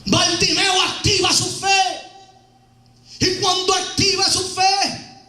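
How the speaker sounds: full-voiced preacher's storytelling; preaching. Preacher tells the congregation about Bartimeo, preaching.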